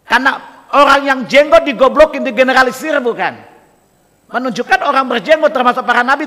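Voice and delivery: loud, high sermon drive; fiery preaching. Acted. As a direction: preach it hard at the audience. Preaching at full force. (ceramah berapi-api dengan suara keras)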